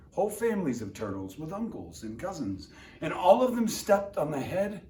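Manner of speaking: usual speaking voice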